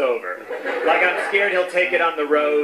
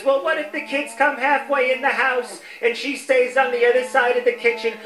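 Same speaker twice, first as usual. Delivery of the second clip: higher-pitched mock pleading relative; brother's wife; 6 semitones higher than usual. Brother's wife bargains to visit Grandma, higher mock voice. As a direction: put on the arguing sister-in-law, higher. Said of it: "But she says".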